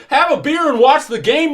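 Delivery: very high, loud incredulous shout; furious echo at full volume; outraged father. Acted. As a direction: yell it back in disbelief. Irate father echoes officer in outrage.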